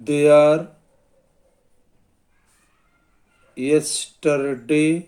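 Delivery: very long gaps between words; slow dictation. Shown as a demonstration. Teacher writing an ellipsis example on the board, slow dictation. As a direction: spell it out word by word at writing speed.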